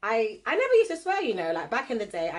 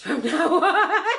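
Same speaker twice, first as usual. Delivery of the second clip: laughing; 9 semitones higher, 8 dB louder, slower than usual. Real, not acted. Laughing suggestion to fans.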